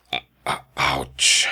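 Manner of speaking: whispered ouch, stung; pained whisper. Acted. Rejected old suitor whispering his heartbreak. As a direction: breathe it out like a hurt heart.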